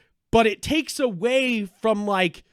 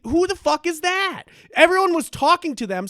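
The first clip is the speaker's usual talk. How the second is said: exasperated; 10 semitones higher, 6 dB louder than usual. Real, not acted. Continuing exasperated rant.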